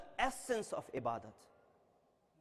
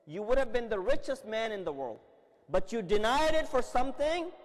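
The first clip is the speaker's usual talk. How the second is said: preaching climax; 5 semitones higher, 6 dB louder than usual. Real, not acted. Preaching at full force.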